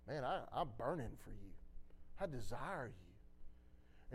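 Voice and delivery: low, hushed, yearning murmur; soft and low, slowing at the end; tender declaration. Retold or imitated. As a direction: confess love very softly, voicing someone else. Voices God's loving words.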